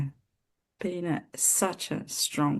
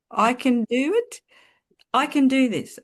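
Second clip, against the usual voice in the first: high and louder, forcing it out; louder on request; 10 semitones higher, 6 dB louder than usual. Retold or imitated. Answering the call to speak up, higher and louder. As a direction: say it louder because you were told to. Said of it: "You need to speak louder".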